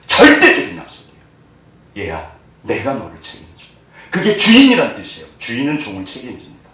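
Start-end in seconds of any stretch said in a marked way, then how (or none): 2.0-3.7 tender reassurance. Acted. God reassures the child.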